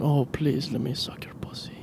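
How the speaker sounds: whispered mock-seductive foreign accent; dirty-talk in a whispery mix of Spanish, Italian and French accents; sexy hybrid accent. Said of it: "A hybrid of multiple accents".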